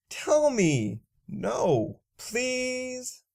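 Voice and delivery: rising, pleading beg. Acted. Narrator begging her friend to tell, high pleading.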